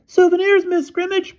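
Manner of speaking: very high piping falsetto. A narrator's reading. Disguised boy answers the headmistress, piping falsetto. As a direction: pipe it up loudly as a fake girl. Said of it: "piped Bruno falsetto".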